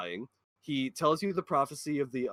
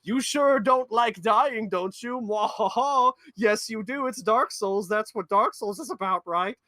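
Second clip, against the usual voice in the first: high, loud sneering villain voice with a mwahaha; mocking witch taunt; 7 semitones higher, 5 dB louder than usual. Witches gloating about dying, high hammy villain voice. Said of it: "they laugh and they scoff".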